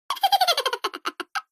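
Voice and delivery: high teasing giggle after the punchline; a soft, sly, teasing laugh. Acted. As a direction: giggle slyly at mother-in-law. Daughter-in-law laughs at her joke.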